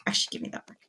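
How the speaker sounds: under-the-breath whisper to himself; whispered aside. Real, not acted. Streamer whispering to himself over loot. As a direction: breathe it out while looting.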